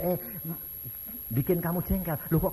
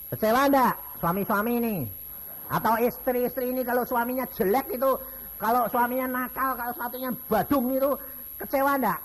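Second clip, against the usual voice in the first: rising, louder preacher's question to the crowd; preaching; 9 semitones higher, 4 dB louder than usual. Acted. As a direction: press the congregation for an answer. Preaching at the congregation. (khotbah yang bersemangat dan makin keras)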